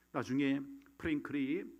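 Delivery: usual speaking voice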